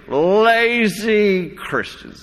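slurred Southern drawl, high and very drawn out; J. Vernon McGee. Acted. Preacher mimics a radio broadcaster on idleness, slurred Southern drawl. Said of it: "this slurred kind of Southern, uh, drawl".

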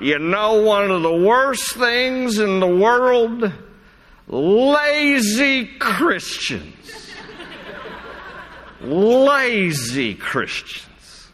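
slurred Southern drawl, high and very drawn out; J. Vernon McGee. Acted. Preacher mimics a radio broadcaster on idleness, slurred Southern drawl. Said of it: "this slurred kind of Southern, uh, drawl".

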